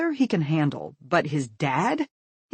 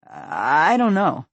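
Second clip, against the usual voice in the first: lying; 7 dB louder, much slower than usual. A narrator's reading. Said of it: "he lies".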